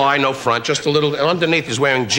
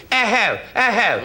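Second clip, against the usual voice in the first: mock posh chuckle; 10 semitones higher, slower than usual. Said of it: "they're all going".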